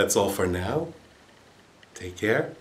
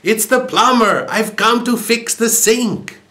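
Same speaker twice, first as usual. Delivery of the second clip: angry yell; 13 semitones higher, 7 dB louder than usual. A narrator's reading. Annoyed plumber yelling at the door, angry shout. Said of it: "And the man yelled angrily".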